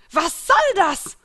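enemy screaming in disbelief; shrieking outrage. A narrator's reading. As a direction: cry out in shocked fury. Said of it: "schrie das Gildenmitglied von Dead End auf".

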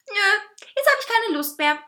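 high, loud diva refusal; pouting, high-pitched petulance; bitchy sulk. A narrator's reading. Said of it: "zickte er".

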